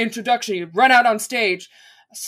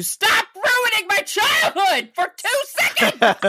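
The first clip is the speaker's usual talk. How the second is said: high mock outrage; indignant shout; 10 semitones higher, slower than usual.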